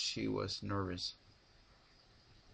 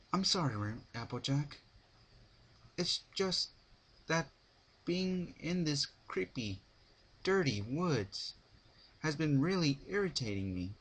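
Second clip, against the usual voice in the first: higher, slightly slowed mare voice; apologetic Rarity; 6 semitones higher than usual. A narrator's reading. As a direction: apologize but stay a bit irritated.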